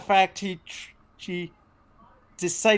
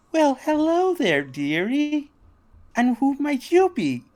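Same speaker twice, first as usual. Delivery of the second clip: much higher, sugary greeting; Penny's sweet voice; 8 semitones higher than usual. Acted. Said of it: "warmly smiles".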